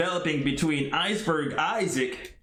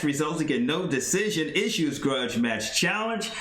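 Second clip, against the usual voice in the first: usual speaking voice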